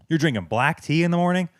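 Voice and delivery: normal talking voice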